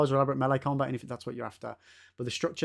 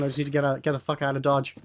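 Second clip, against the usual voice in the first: ordinary talk, nothing marked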